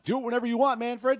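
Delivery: high, loud mock fan support; hammed-up rally cry; mock cheering. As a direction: cheer in exaggerated, high-pitched enthusiasm.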